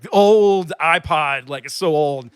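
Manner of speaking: put-on "old" stretched long and loud, both times; stress on OLD. Man talking down his iPod to thieves, drawn-out stress on "old". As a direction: lean hard and slowly on the word "old". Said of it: "just really emphasize the word old".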